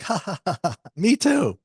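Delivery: high mock laugh into the words; high, acted-out chuckling; imitated chuckle. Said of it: "And she chuckled".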